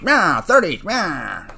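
put-on loud, high old-movie gangster squawk; hammed-up thirties mobster impression. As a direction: bleat it like a cartoon old-time gangster. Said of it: "impression of a thirties gang".

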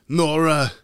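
loud, high, slow bad Austrian accent; terrible Schwarzenegger accent. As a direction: ham up a clumsy Austrian Mr Freeze. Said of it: "that's a terrible".

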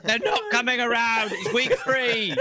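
put-on high English accent, laughing, slowing down; laughing British complaint. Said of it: "He was like".

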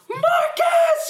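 high, loud shout of the name; panicked mom screaming his name; frantic shout. Retold or imitated. Mom shouting after accident.

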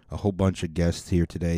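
ordinary delivery

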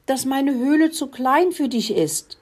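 high-pitched fretful complaint; wailing. A narrator's reading. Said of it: "jammerte Muckelinchen". (hohes, besorgtes Jammern)

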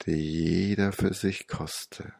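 ordinary delivery, nothing marked

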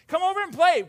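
high, loud call to playmates; children calling. Acted. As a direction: shout an invitation like a kid. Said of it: "these children that are saying".

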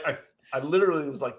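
normal talking voice

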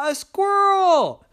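high, slow, loud gasp; high surprised cry; startled squirrel. Acted. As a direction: shock at the mirror. Startled by own reflection.

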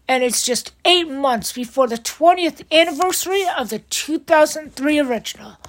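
ordinary talk, nothing marked

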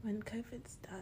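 very soft, hushed repeat; whispered echo. Pretend. Friend echoing a vow about covid, soft whisper. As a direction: whisper it back solemnly.

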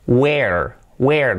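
"Where" as "we-er", both parts distinct; well-separated syllables. Shown as a demonstration. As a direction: draw out "Where" with a clear e, then r. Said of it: "dos sílabas bien separadas". ("Where" en dos sílabas bien separadas)

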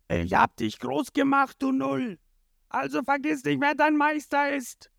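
high, strained villain scolding; angry rebuke. A narrator's reading. Krieg scolding his officer, high angry rant.

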